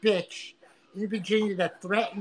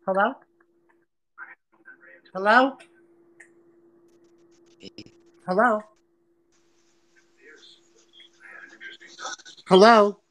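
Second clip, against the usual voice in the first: louder rising "Hello?" thrown into silence; calling out; 10 dB louder than usual. Real, not acted. Calling to a silent guest on the stream. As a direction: call out to someone who won't answer.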